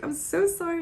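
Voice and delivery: high-pitched mock-weepy apology; high, pleading retold sorry; retold tearful apology. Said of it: "my tears just welled up".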